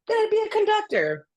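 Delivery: high, sing-song, dreamy repeat; mock naive. Mocking her younger self's epiphany, high and sing-song. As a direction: toss it off like a starry-eyed kid.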